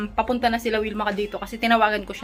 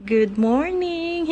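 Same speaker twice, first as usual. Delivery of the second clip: sing-song greeting; 9 semitones higher, much slower than usual. Acted.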